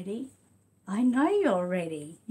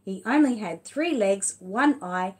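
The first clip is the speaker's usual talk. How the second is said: big, louder read-aloud voice, stretched slowly; reading to children; 7 dB louder than usual. Acted. Reading The Wonky Donkey to her son and viewers, lively read-aloud. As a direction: tell it like a lively picture book for little ones. Reads picture book aloud.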